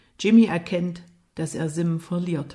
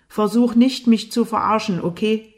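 louder, higher threat; angry warning; 4 semitones higher, 6 dB louder than usual. A narrator's reading. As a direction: warn him off with raised voice. Jimmy droht Lukas im Streit. (wütend und drohend)

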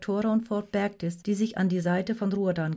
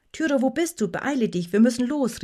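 raised, higher voice calling someone; urgent call; 5 semitones higher, 3 dB louder than usual. A narrator's reading. Vectora hurrying Tyra to leave, calling out. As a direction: call out to hurry her along. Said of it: "rief Vectora".

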